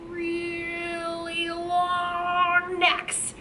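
very slow, sustained "really long necks"; stretched out. Shown as a demonstration. Stretches words to show long necks.

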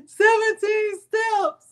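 high, slow, words stretched through laughter; laughing. Real, not acted. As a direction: still giggling as she says it. Still laughing at chat.

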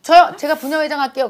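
high, loud mock eager mom; eager volunteer. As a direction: ham up a pushy mom volunteering.

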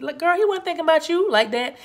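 put-on high, loud girlfriend-style reality check; sassy telling-off. Blunt girlfriend-style aside to viewers.

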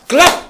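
high, loud slapped-out clap; sudden high clap noise; sharp clap sound. Retold or imitated. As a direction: snap the sound out like a trap shutting. Sudden collapse sound effect.

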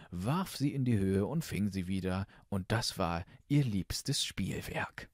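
plain reading voice

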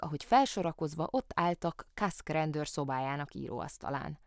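usual speaking voice

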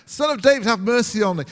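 loud, high cry for mercy; shouting out. Retold or imitated. Blind beggar calling out to Jesus from the roadside, loud and high. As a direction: call out desperately over the crowd. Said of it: "shouting out".

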